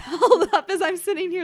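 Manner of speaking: amused disbelief, laughing mid-line. Real, not acted. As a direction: laugh through it, delighted. Amazed at the old book.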